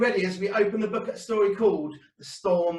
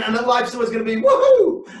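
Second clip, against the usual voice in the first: mock cheer; 7 semitones higher, 8 dB louder than usual.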